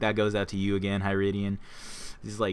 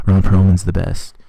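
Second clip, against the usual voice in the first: loud burst of fan praise; excited shout; 4 semitones lower, 16 dB louder than usual. Real, not acted. Fan shouting his love for Ron Perlman, loud. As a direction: blurt it out loud for the actor you love. Gushing about favorite actor.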